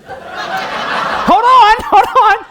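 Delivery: high, laughing hold on; cracking up while stalling. Real, not acted. Pastor laughing as he tries to start his story.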